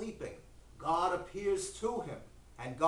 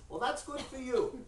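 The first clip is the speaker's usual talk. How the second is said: higher-pitched little old man, lightly acted; a hint of an old man, pitched up; lightly voiced old man; 7 semitones higher than usual. Old man answering his host, lightly voiced old man.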